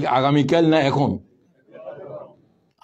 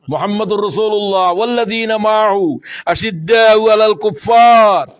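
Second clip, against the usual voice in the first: loud, slowing to a shout on কুফফার; preacher thundering the Quran verse; 4 semitones higher, 5 dB louder than usual. Acted. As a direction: proclaim the verse at full force.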